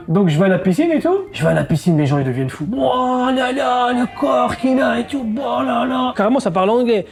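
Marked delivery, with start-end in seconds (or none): 2.8-7.0 gushing admirers. Retold or imitated. Pool guests admiring his body, swooning.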